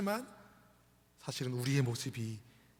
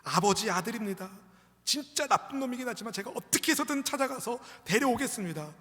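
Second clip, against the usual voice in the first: raised, emphatic plea to father; acted earnest vow, building higher; devoted son appealing; 6 semitones higher, 5 dB louder than usual. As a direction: insist on rescuing your brother. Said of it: "아버지에게 이렇게 말씀을 드리지 않았을까요".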